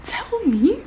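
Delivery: high, loud and slow, overexcited; squealing book reaction; excited outburst. Real, not acted. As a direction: burst out in fangirl excitement. Excited outburst about plot twist.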